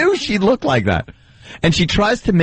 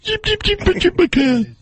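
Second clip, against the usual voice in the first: high mock announcer repeating the name, turning into squeaks; [name]; 5 semitones higher, slower than usual. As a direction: repeat her name in a silly put-on voice. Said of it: "By saying my name".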